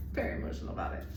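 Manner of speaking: hushed, low, voice dropping away; emotional. Real, not acted. Apologizing for being emotional while channeling, real choked-down emotion. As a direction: say it softly, overcome with feeling. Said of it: "I'm sorry I'm emotional".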